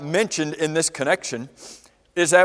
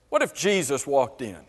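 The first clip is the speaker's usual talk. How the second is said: normal talking voice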